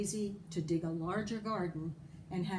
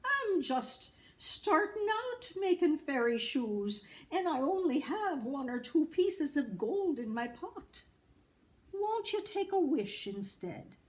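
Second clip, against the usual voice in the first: leprechaun pleading; 9 semitones higher, 4 dB louder than usual. A narrator's reading. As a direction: beg the mortal to let you go. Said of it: "he pleaded".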